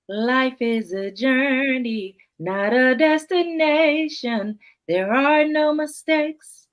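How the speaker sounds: slow, lifted spoken-word delivery; poem recital. Acted. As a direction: perform the poem slowly, rising to song. Poet performing spoken word poem.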